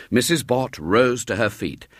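ordinary delivery, nothing marked

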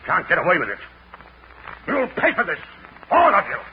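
high, loud panicked rage; furious outburst. Acted. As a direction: scream threats as they drag you. Prisoner erupts at officers.

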